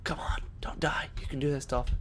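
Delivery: hushed to himself; whispering. Real, not acted. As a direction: whisper it tensely. Self-encouragement under breath.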